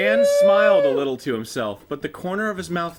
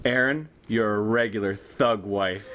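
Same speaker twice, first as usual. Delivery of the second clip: teasing; 8 semitones lower than usual. Acted. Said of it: "he teased".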